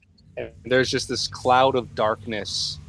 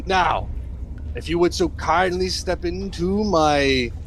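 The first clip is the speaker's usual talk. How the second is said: higher, theatrical hero voice; courtly hero; 5 semitones higher, slower than usual. Acted. Hero invites party into the bubble of darkness, courtly. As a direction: usher them in with grand politeness.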